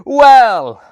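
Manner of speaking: big loud theatrical opener; booming well. Acted. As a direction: boom the word out.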